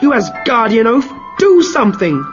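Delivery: wife furiously berating her husband over her lost hair; shouting angrily. Acted. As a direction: scream at the useless husband. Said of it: "shouted at her husband".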